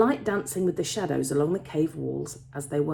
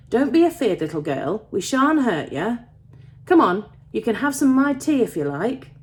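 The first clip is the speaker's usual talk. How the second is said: put-on raised, friendly Yorkshire young man; Yorkshire accent; 5 semitones higher, 6 dB louder than usual. A narrator's reading. Young miner inviting a scared girl to tea, Yorkshire accent. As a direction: coax her over in a Yorkshire accent. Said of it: "they're from Yorkshire in my head".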